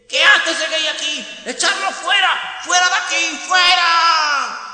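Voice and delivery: very high, loud shrieking; shrieking mob. Acted. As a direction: scream it in a frenzy. Mob voice throws out man.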